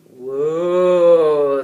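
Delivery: put-on loud amazed boys' whoa; awed whoa. A narrator's reading. The king's sons gape at the dragon, amazed. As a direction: let awe burst out. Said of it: "they replied in unison".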